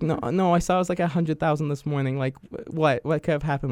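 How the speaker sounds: high, amazed imitated questions; surprised disbelief. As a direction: mimic her shocked confusion. Sister learns of viral views.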